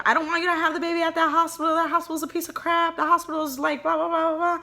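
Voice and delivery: voicing her man's rant; imitating man screaming. Man on phone to his pregnant partner, high mock rant. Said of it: "he was, like, screaming".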